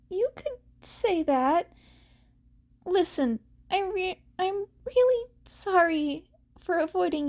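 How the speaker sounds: a hint of a guilty boy; flustered apology. A narrator's reading.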